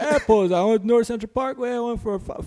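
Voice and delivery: high, loud buddy caricature; Javi impression. Buddy recounting his run, high put-on voice. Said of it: "this dude actually talks like this".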